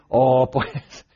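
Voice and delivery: mock the gentle advisers, sugary and coaxing. Someone urging Ezra to love the sinners, in a mock coaxing voice. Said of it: "y dices".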